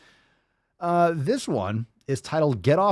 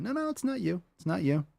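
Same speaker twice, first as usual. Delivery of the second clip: retold soothing; 6 semitones higher than usual. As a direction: retell comforting the cowering dog. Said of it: "he was, like, cowering".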